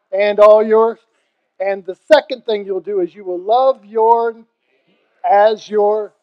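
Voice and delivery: loud, slow sermon cadence, driving each "and all your"; preaching at full force. Acted. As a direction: preach it to the back row.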